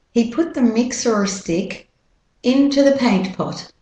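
American R sounded in "mixer"; American-accent model sentence. Shown as a demonstration. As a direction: keep the R in "mixer", American style. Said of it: "And with the American accent".

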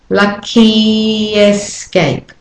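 "Lucky escape." stretched slowly; slow demo. Shown as a demonstration. Teacher slowing a phrase to show linking. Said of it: "notice that as I go".

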